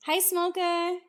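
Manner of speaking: high-pitched, softening gush over a prized gadget; over-the-top adoring coo; cringey gushing. Real, not acted. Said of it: "I'm being cringe".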